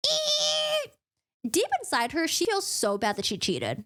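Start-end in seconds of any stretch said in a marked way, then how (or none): 0.1-0.9 long, very high mock eek; drawn-out cartoonish shriek; high-pitched squeal. Squeal as she pulls the lever.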